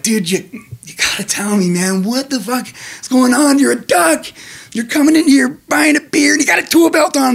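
high, exasperated bartender. Acted. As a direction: demand answers like a fed-up barman. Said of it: "the bartender's like".

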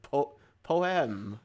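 "poem" in two distinct syllables, po-em, second drawn out; Mister Simmons's pronunciation. Retold or imitated. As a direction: say "poem" as a stretched po-em.

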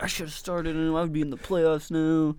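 half-hearted imitation of a furious rant; mock yelling. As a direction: imitate an angry complaint without really yelling. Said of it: "come on here to start yelling".

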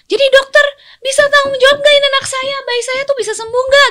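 high, loud imitation of an irate parent; angry patient's parent. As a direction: demand answers from the doctor in mock outrage. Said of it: "kenapa pasien marah". (menirukan orang tua pasien yang marah)

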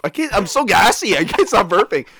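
ordinary talk, nothing marked

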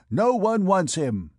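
high, projected auction call; auctioneer. A narrator's reading. Clopin on his hogshead to the crowd, auctioneer's cry. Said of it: "imitating the accent of an auctioneer".

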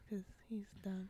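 soft unvoiced whisper. Real, not acted. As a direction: whisper it as a quick aside. Aside comment.

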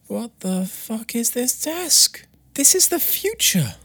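slow, high amazed disbelief. Real, not acted. Googling the standing desk, slow, high, amazed disbelief. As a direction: marvel at it, drawing the words out high.

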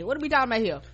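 ordinary talk, nothing marked

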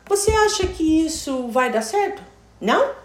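high-pitched questioning tune ending on "Não"; demonstrated Brazilian question melody, lifted on "Não"; Portuguese question intonation. Shown as a demonstration. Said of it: "A gente tenta usar isso em inglês".

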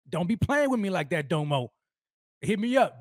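normal talking voice

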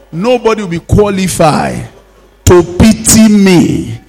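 loud, ringing preacher's declarations; forceful revival-meeting prayer; full-force prayer. Acted. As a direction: drive each prayer line out to the congregation. Leads congregation in forceful prayer.

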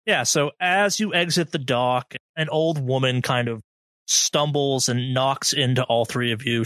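ordinary delivery

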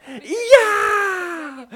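high, drawn-out celebratory yell; mimicked victory yell. Commentator mimicking the player who celebrated too early, high drawn-out yell. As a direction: imitate a pumped player's long scream.

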